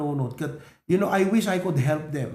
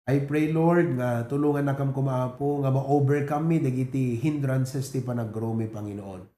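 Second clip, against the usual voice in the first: closing prayer; 5 semitones lower than usual. Acted. Pastor praying for the church after the message, hushed.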